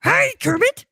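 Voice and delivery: creepy clown calling down the sewer; Pennywise impression. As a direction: lure him in with a creepy high clown voice. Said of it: "Pennywise luring Kermit into the sewer".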